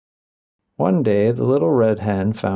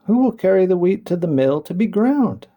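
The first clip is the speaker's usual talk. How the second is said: calling out; 8 semitones higher than usual. A narrator's reading. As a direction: call out to the animals. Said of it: "she called out".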